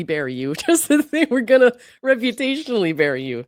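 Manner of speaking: laughing while reading. Retold or imitated. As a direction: read it out through amused laughter. Cracks up reading lawyer's silly question.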